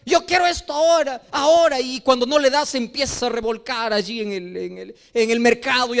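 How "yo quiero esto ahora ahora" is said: put-on whiny child. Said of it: "un niñito cuando va al mercado".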